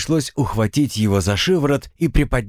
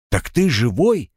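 surprised question, pitched up; 6 semitones higher than usual. A narrator's reading. Narrator finds the floating dead man alive, surprised. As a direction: ask it in lively astonishment. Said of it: "удивился я".

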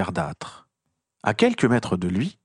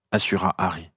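usual speaking voice

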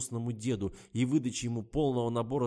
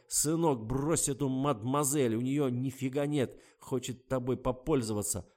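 father quoted; 4 semitones higher, 6 dB louder than usual. Retold or imitated. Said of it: "Прав папа ... сразу сказал".

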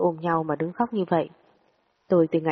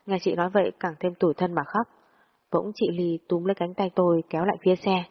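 usual speaking voice

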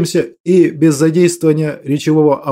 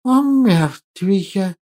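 slow lisping hiss, softer; hissing creature voice; 2 semitones higher, 4 dB quieter, much slower than usual. Acted. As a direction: drag it out in a hiss. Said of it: "Перевёл я его шипение".

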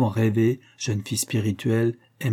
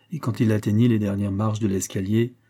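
usual speaking voice

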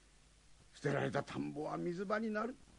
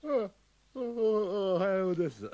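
startled stammer; 4 semitones higher, 4 dB louder than usual. Acted.

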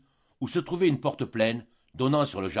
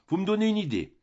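mocking, higher; 8 semitones higher than usual. A narrator's reading. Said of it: "d'une voix railleuse".

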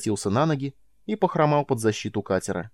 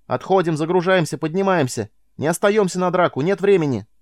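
shouting orders; 7 semitones higher, 4 dB louder than usual. Acted. Miles orders retreat in battle.

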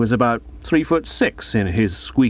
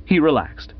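plain reading voice

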